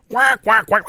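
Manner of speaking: mock quack quack; a playful duck imitation. Ducks waddle by.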